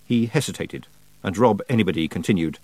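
usual speaking voice

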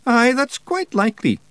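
high, self-satisfied Scottish voice; swelling with pride. A narrator's reading. Rob accepts praise for his explanations, proud. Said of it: "swelling with pride".

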